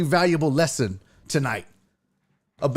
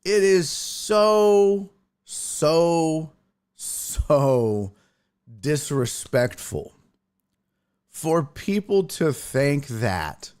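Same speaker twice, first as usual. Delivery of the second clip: slow emphatic; much slower than usual. Real, not acted.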